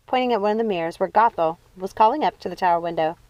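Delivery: usual speaking voice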